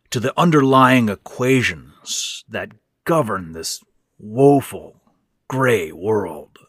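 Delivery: slow, faraway, half to himself; distant musing. A narrator's reading. Old man drifting off, speaking to the corner, distant and slow. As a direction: talk past him into the corner. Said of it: "speaking to someone in the corner".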